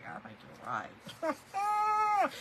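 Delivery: slow, high words through laughter; laughing agreement. Retold or imitated. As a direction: agree while cracking up. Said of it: "I was like".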